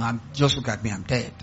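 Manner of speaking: low, soft mock misery; self-pitying. Church member seeking pity from the pastor, mock self-pity. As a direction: act pitiful, dropping low and quiet. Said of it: "because you want self-pity".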